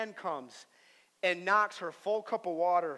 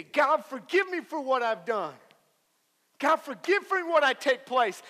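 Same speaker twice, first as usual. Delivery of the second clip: loud, raised-pitch preaching plea; acted forceful plea; 7 semitones higher, 9 dB louder than usual. Believer asking God for forgiveness, acted loud plea.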